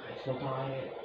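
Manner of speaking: soft, worn-out murmur; quiet sigh. Real, not acted. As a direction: say it under your breath. Quiet repeat after rant.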